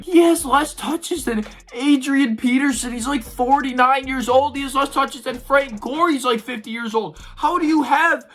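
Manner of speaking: high, heated disbelief, pushing the pitch up; exasperated rant. Real, not acted. Venting to viewers about Miles Sanders' touches, high and exasperated. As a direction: rant like you can't believe the numbers.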